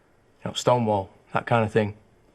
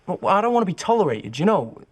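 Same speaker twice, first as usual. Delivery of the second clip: high, heated protest; indignant; 7 semitones higher, 4 dB louder than usual. Acted. Protesting to a listener about the vote, indignant. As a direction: push it out with rising indignation. Protests being merely tolerated.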